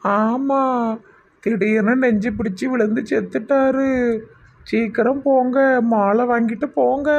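put-on high, drawn-out old woman's voice; bereaved old wife. Old wife tells callers of the heart attack, high bereaved voice. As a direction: announce the death in a high, stretched voice.

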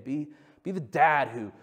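normal talking voice

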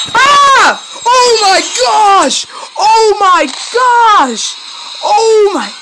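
terrified scream. Real, not acted. Jumpscare in horror game.

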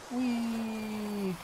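high playful whee; gleeful whee. Real, not acted. Riding the zip line.

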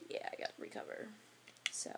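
breathy unvoiced whisper. Real, not acted. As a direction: let it fade to a whisper. Tired after dancing.

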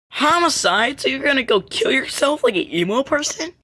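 high, loud incredulous question; shocked disbelief. Acted. Reacting to talk of homicide, high shocked disbelief. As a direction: blurt it out, high-pitched and appalled.

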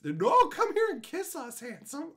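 high, slow seductive mock voice; Tony in a wig, cooing high and slow to lure him; mock wife. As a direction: coo it like a fake wife. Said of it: "see that's".

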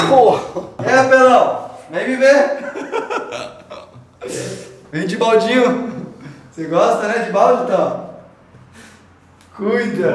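loud, high, mocking calls; teasing shout. Real, not acted. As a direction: yell out to tease a friend. Teasing friend over the bucket.

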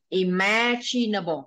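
"imaginable" drawn out very slowly, syllable by syllable; slow model. Shown as a demonstration. Teacher drills the word slowly for a student. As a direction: spell out "imaginable" slowly with your voice. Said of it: "Đây chữ chờ nhá".

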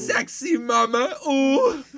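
loud, high, drawn-out Indian caricature; Indian accent. Pretend. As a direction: leer in a hammed-up Indian accent. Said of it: "what about Indian Sopranos?".

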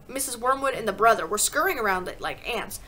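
ordinary delivery

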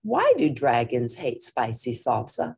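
ordinary delivery, nothing marked

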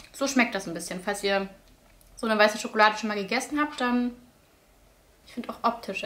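just swallowed a spoonful; tasting while speaking; talking between bites. Real, not acted. Seen 0: spoon and ice cream tub in hand; bite just before, no clear full mouth.

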